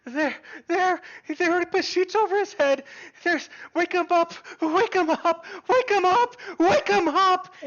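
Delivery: high, loud wailing plea, building; hysterical, beyond reason. Acted. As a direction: cry out frantically, losing all reason. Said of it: "she is kind of beyond, um, reason".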